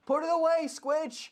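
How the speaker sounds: put-on high, loud Welsh accent yell; thick Welsh accent. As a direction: bellow it in a broad Welsh accent. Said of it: "like, thick Welsh accent say behind".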